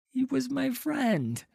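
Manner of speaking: very high, pained plea; anguished grief. Acted. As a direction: let the hurt lift the voice right up. Boy answers, grieving.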